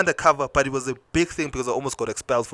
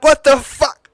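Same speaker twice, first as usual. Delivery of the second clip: high, loud what the fuck; outraged shout; 12 semitones higher, 9 dB louder, slower than usual. Retold or imitated. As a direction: blurt it out in shocked outrage. Outraged at the school staff.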